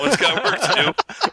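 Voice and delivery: laughing through the words; giggling as she says it. Real, not acted. Laughing while announcing Owen's task.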